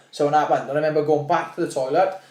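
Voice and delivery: ordinary talk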